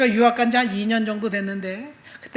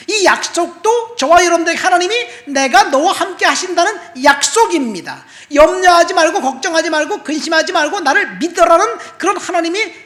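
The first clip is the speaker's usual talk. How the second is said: louder, higher preaching, stretched out and urgent; preaching at full force; 7 semitones higher, 9 dB louder than usual. Acted. Preacher stirring the congregation, loud and fervent. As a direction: drive the testimony home to the congregation.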